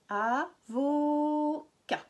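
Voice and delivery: avocat slowly split, the "vo" held long; stretched middle O. Shown as a demonstration. Teacher locating O in the middle, slow split syllables. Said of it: "il est au milieu".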